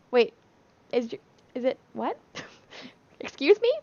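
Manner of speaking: falter, then squeak in re-enacted disbelief; retold disbelief. Retold shock at news of lump.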